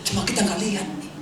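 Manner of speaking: usual speaking voice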